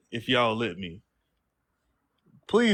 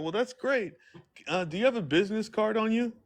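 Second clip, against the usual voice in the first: mock polite businessman; 9 semitones higher, 4 dB quieter than usual. Playing polite businessman again.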